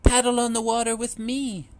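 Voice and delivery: sing-song calling mother duck. A narrator's reading. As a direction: call them over, mama duck. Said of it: "Mama called again".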